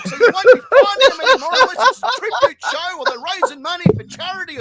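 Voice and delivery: shouting, laughing and speeding up; loud laughing rant. Pretend. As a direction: yell it half laughing at the tranquil crowd. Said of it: "you'll be like".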